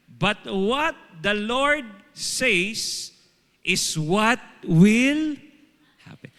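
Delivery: slow, loud, emphatic reading; slow scripture reading. Shown as a demonstration. Preacher proclaiming the verse to the congregation, slow and loud. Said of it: "sabi dyan".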